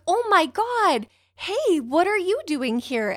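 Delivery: retold squeal of recognition, pitched well up; delighted surprise. Greeting a local photographer she didn't expect, delighted surprise.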